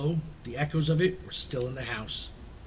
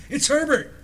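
high-pitched, louder excited cry; screamed; 12 semitones higher, 6 dB louder than usual. A narrator's reading. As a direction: call it out high and urgent, as the old woman. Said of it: "she screamed".

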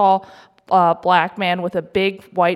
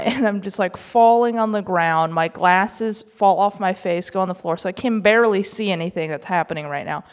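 ordinary delivery

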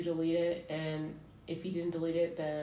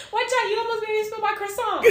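much higher, louder, laughing squeal; mock Vine guy; 9 dB louder than usual. Laughing imitation of the Vine guy dropping his croissant.

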